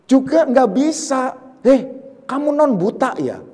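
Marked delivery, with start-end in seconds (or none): 1.6-3.4 higher, pointed mock question; accusing sneer. Teacher mimics someone needling a blind man, accusing and higher. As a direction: needle him with a loaded label.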